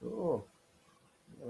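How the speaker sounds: ordinary talk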